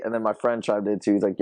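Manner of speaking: normal talking voice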